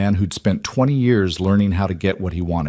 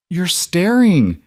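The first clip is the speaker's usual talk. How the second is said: smiling voice; 9 semitones higher, 4 dB louder, much slower than usual. Acted. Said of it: "he could hear the smile in her voice".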